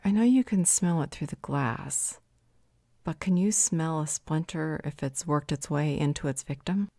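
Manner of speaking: ordinary delivery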